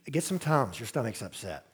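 quiet soothing reassurance; soothing wife. Retold or imitated. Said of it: "You know, just".

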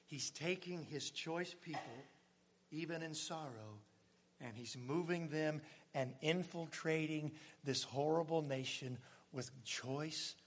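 quiet sermon reverence; hushed reverence. Acted. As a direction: let it sink in gently. Soft reverent reflection.

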